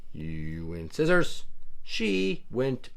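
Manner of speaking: very slow, drawn-out result; slow dramatic reveal. Acted. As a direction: reveal each pick slowly.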